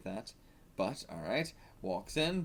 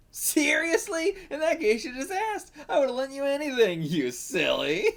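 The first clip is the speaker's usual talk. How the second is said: put-on teasing girl; 12 semitones higher, 4 dB louder than usual. Stepsister teasing Karu about the uniform, high playful voice. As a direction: tease her sweetly.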